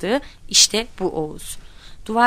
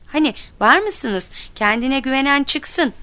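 higher-pitched taunt to the whole group; daring the others; challenging; 7 semitones higher than usual. A narrator's reading. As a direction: throw down a dare. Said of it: "diye bir daha hepimize meydan okudu".

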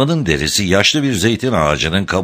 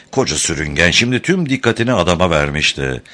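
ordinary delivery

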